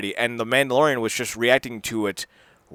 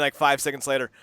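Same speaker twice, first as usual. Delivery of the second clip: ordinary talk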